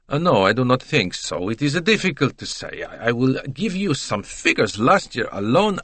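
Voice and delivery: put-on hesitant then eager Italian accent; stall, then launch into a sales pitch, Italian style; voluble Italian salesman. Voices Italian digressing.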